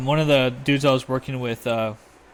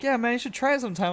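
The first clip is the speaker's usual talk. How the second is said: higher, fast, eager buddy voice; mock buddy urging; 10 semitones higher, faster than usual. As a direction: sell it like a reckless hunting pal.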